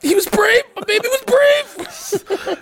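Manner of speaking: very high hammed-up wail; mock doting grief. Partner hearing of hero at memorial, mock doting wail. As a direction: gush in a squeaky mock-tearful voice.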